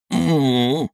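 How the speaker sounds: pained moan. A narrator's reading. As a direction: moan through the pain in the arm. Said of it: "простонал он". (стон боли)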